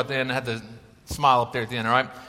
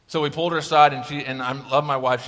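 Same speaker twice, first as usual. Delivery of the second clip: ordinary delivery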